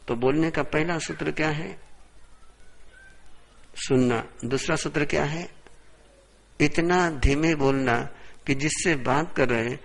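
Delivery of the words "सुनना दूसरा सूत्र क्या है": usual speaking voice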